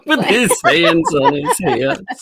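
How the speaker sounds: giggling through the words; laughing. Real, not acted. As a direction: laugh it out high and slow. Laughing while repeating.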